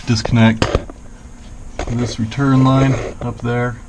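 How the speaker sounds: talking while lying under a car; strained voice from under a car. Real, not acted. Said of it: "I'm under the car".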